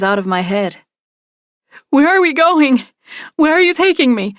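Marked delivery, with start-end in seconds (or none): 1.9-4.3 put-on much higher and louder, frightened and childlike; cry like a little girl. A narrator's reading. Kidnapped woman begging to know where he's taking her, frightened cry. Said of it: "I cry, sounding like a little girl".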